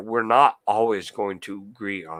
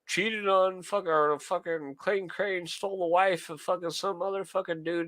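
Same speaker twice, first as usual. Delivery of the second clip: mocking gossip streamers; 9 semitones higher than usual. Mocking livestreamers spreading gossip about Clayton Crane, high sneering parody. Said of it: "these fools are going live".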